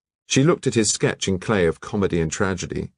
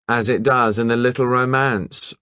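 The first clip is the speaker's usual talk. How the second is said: drawling; 5 semitones higher, 3 dB louder than usual. Acted. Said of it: "drawled Malmsley".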